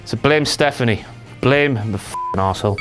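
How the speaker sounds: low growl. Acted.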